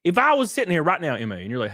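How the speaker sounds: usual speaking voice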